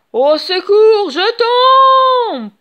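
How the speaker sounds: very loud, high, slow panicked cry; falling scream. A narrator's reading. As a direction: scream as you drop. Hook falls from the yard.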